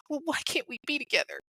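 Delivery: mocking his hurt feelings; whiny hurt boyfriend. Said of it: "he's in his feelings".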